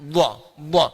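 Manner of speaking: "Dha" as the emphatic Arabic dad, loud; Arabic letter dha. Shown as a demonstration. Teacher demonstrates the Arabic dha sound for the class. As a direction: hit "dha" hard, deep in the throat. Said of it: "di bahasa lain nggak ada".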